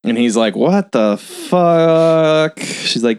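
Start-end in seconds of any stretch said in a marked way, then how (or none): 0.6-2.6 slow, high, dumbfounded "what the fuck"; drawn-out shock. Retold or imitated. Projectionist confronted with her sick dad, drawn-out shock. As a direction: stretch it out slowly, pitched higher.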